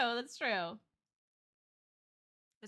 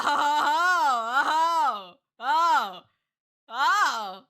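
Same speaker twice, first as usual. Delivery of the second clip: repeated "ho" cries swelling louder; escalating whoas; 11 dB louder, much slower than usual. Real, not acted. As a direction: build from whoa to loud hooting oh. Stunned, escalating reaction to art.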